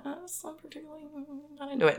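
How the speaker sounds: high, soft reluctant squirm; squeamish distaste. Acted. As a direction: wince through it in a small high voice. Mimes distaste for some professions.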